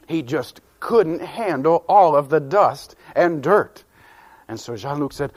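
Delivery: plain reading voice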